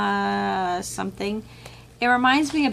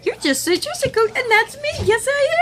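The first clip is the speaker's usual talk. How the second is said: gushing fan; 7 dB louder than usual. Retold or imitated. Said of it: "she's like".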